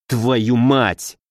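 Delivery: frustrated curse. A narrator's reading. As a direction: curse under the strain of a lost chance. Lieutenant curses as enemy escapes.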